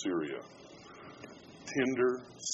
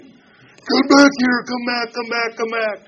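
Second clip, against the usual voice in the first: high, full-force call to come back; top-of-lungs shout; 13 semitones higher, 12 dB louder, slower than usual. Retold or imitated. Churchgoers yelling for runaway Derek to come back, top-of-lungs shout. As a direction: yell it as loud as you can across a distance. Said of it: "At the top of our lungs".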